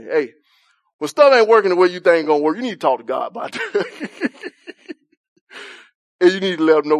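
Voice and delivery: casual shouted takeaway; loud casual street delivery. Shown as a demonstration. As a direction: say it plain and loud like you mean it. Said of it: "this is how we say it in D N A".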